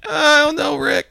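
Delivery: very high, loud hesitant cartoon kid; hammed-up worried sidekick; high-pitched doubtful whine; Morty. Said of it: "Rick and Morty guy fucking bit".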